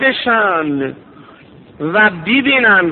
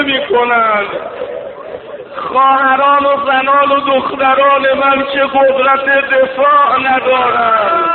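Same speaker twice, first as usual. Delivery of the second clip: higher, slow wailing plea; climactic rowzeh lament; 4 semitones higher than usual. Acted. Preacher voicing the Imam's plea for the women, wailing. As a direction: build the cry to defend the tents.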